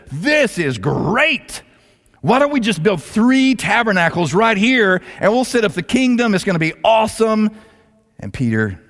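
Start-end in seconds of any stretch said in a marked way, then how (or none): 0.1-7.5 excited. Retold or imitated. Peter at the Transfiguration proposes tabernacles, excited. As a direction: bubble over with naive enthusiasm. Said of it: "Peter is so excited about what's happening".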